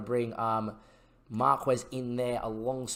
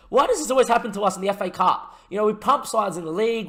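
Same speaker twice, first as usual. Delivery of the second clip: exasperated; 7 semitones higher, 5 dB louder than usual. Real, not acted. Exasperated at another Cup scare.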